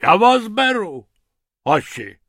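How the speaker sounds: lightly voiced hippo, loud and pompous; a big animal introducing himself grandly.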